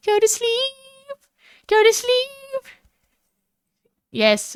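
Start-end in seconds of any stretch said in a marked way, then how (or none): 0.1-2.6 very high, long held "sleep"; squeaky drawn-out bedtime urging; mock lullaby voice. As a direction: coo it way up high, stretching the last word. Imitates a lulling sleep voice.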